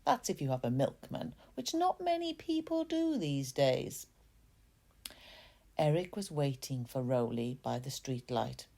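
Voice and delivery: usual speaking voice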